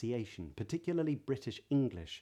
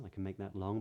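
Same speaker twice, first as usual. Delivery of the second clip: ordinary delivery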